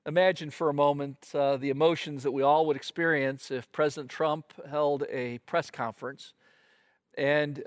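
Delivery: ordinary talk, nothing marked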